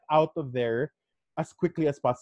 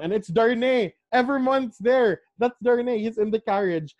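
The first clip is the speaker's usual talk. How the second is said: high, eager burst of excitement; bursting to share the twist; excited reveal; 8 semitones higher, 3 dB louder than usual. Real, not acted.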